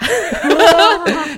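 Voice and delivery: a high, loud burst of laughter; bright laugh. Real, not acted. Daughter laughing after her mock threat to dad, high bright laugh. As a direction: laugh off your own joking threat.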